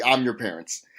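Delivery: usual speaking voice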